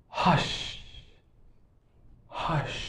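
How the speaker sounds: whispered "hush"; very quiet hush trailing into sh. Shown as a demonstration. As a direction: shush someone with a long, soft whisper. Demonstrates an interjection for quieting someone.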